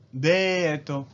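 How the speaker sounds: deto as de-to, long e; Japanese loanword. Shown as a demonstration. Teacher showing the Japanese reading: デート. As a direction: date said as deto. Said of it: "se convierte en".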